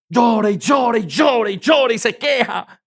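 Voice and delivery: slow, shouted mock wail; mock wailing complaint. Preacher mimicking someone who suffers complaining, mock wailing. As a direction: drag out each repeat like endless moaning. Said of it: "usted sufra quejándose".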